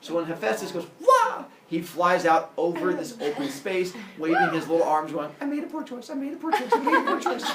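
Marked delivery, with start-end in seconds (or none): 1.0-1.5 high, loud comic "wah" cry; mock scream. Thrown Hephaestus flying off the mountain, mock scream. 5.4-7.5 put-on high, squeaky panicked child voice; hammed-up regret, repeated as it climbs higher; mock panic. Little Hephaestus flailing over the ocean, mock panicked wail.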